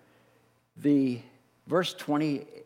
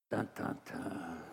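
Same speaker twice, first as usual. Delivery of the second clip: ominous sound effect; 10 semitones lower, 16 dB quieter, slower than usual. Pretend. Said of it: "The music goes down".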